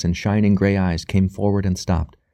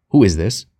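deliberately; 5 dB louder than usual. Acted. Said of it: "he said deliberately".

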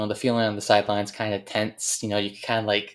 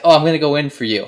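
fast, loud, higher grinning boast; gleeful plan; 5 semitones higher, 9 dB louder, faster than usual. Retold or imitated. Injured friend telling Zach he'll play for him, fast and gleeful. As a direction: rattle it off like it's genius.

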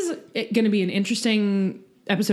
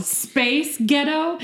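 put-on Scottish accent; 6 semitones higher, much slower than usual. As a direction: roll it out like a Scot. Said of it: "in a Scottish accent".

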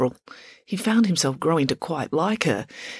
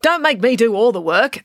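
louder, higher, bossy order; barked command; 6 semitones higher, 5 dB louder than usual. A narrator's reading. April ordering Tom to move faster, barked command. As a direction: snap it out with authority. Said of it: "April barked this command with such authority".

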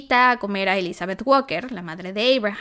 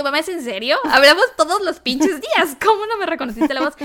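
exasperated; 6 semitones higher, 4 dB louder than usual. Retold or imitated. Daughter exasperated at mom.

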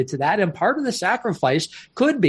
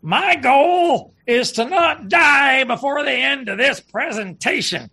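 put-on booming old man voice; 7 semitones higher, slower than usual. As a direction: boom it out like an old heckler. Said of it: "who had a big booming voice".

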